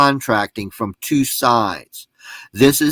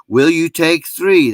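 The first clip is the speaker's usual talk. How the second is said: haggling buyer; 5 semitones higher, 4 dB louder than usual. Retold or imitated. Haggling buyer at yard sale.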